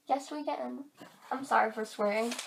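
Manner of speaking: usual speaking voice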